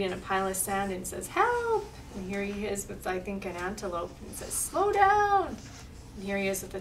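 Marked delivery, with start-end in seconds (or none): 1.3-1.9 very high, held cry; acted wail, pitched way up; cry for help. Buried snail calls for help. 4.5-5.6 high, drawn-out call; storybook plea, pitched way up. Acted. Snail calls to racing antelope.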